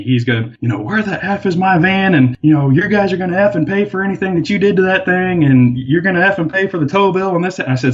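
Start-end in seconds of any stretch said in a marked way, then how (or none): 0.8-7.6 high-pitched angry rant, retold; laying into someone. Angry man confronts park worker over his towed van, mock irate rant. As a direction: imitate a man tearing into you, voice pitched up. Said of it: "he just lays into me".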